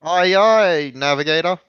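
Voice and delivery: high, loud sailor acknowledgment; captain answers the navigator, high and loud; mock salute. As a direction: bark it up high like a deckhand.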